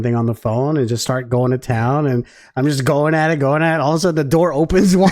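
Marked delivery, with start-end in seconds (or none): none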